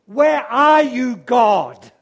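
loud, slow cry to God; desperate plea. Acted. Preacher voicing the doubter's question, loud desperate plea. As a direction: call out to an absent God. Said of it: "take two steps to".

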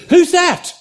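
startled man. Acted. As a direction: jump at a voice out of nowhere. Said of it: "The man said".

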